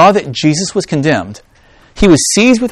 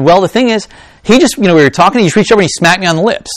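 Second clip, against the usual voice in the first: pretend wife explaining; 5 semitones higher, 5 dB louder, faster than usual. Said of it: "my wife came to me".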